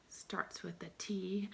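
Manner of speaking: slow, unvoiced whisper; slow secret hint; suspense whisper. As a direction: hush it to build suspense. Teacher builds suspense for the answer.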